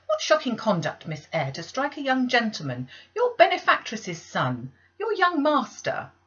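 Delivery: high, loud outraged reproach; shocked scolding. Acted. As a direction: scold her hard, slowing at the end. Maid scolding the girl.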